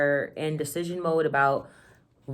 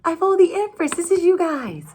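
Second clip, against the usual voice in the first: high and loud card reveal; high, loud delighted reveal; excited reveal; 14 semitones higher, 7 dB louder than usual. Real, not acted. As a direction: announce it with a thrill.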